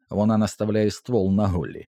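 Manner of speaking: plain reading voice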